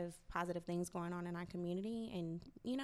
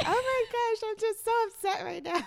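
higher and louder mock distress, half-laughing; mock upset self; 13 semitones higher, 7 dB louder than usual. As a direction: ham up your own overreaction to a friend. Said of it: "I would call my girlfriend like".

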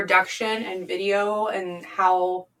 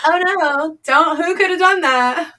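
higher, louder fake shock; mock innocent; 9 semitones higher, 7 dB louder than usual. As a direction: play innocent. Mock innocence about leak.

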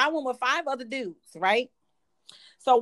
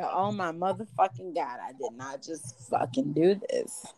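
much lower, softer dismayed mutter; horrified hush; 8 semitones lower, 5 dB quieter than usual. Retold or imitated. Waking up horrified beside the guy, low and hushed. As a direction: say it under your breath, appalled.

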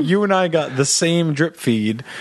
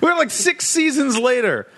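high, quick incredulous complaint; exasperated son; 11 semitones higher than usual. Retold or imitated. Son asking his dad why he's talking about season one, high disbelief. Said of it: "And I'm like".

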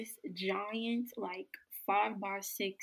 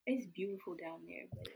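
soft whispered aside; 2 semitones lower, 10 dB quieter than usual. Real, not acted. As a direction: say it under your breath.

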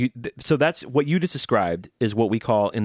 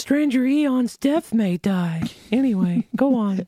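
much higher, put-on voice; goofy cartoon wrestler voice; Hulk Hogan impression; 12 semitones higher, slower than usual. Guest mocks Hulk Hogan to the host in a high put-on voice. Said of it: "talks like this".

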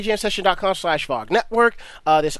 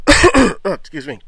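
choking on a drink; 2 semitones lower than usual. Real, not acted. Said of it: "Something went down the wrong pipe".

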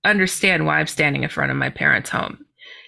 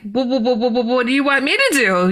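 nervous stammered question; stuttering; 5 semitones higher, faster than usual. Retold or imitated. Caught not listening, stammering nervously. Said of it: "I stuttered again".

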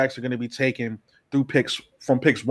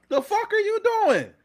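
even higher, squeaking with disbelief; exasperated; 4 dB quieter than usual. Real, not acted. Fan repeating the outraged question, even higher and squeakier. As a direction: let the voice crack up high in outrage.

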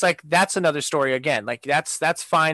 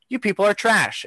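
indignant, higher-pitched telling-off; retold angry insult; 6 semitones higher than usual. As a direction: replay a disgusted insult, pitched up. Retold insult to dog owners.

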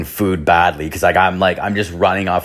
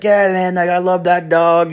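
much higher, put-on voice for the homeless guy; 10 semitones higher than usual. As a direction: play the dog owner in a raised, cartoonish voice. Drunk teller voices the homeless man.

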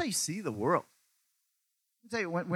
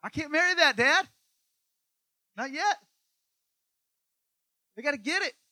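pleading Jesus; 10 semitones higher, 4 dB louder than usual. Retold or imitated. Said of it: "'cause he's like".

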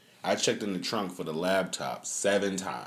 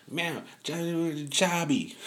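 imitating his kid; 10 semitones higher, much slower than usual. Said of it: "She be like".

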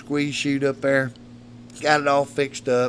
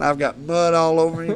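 ordinary delivery

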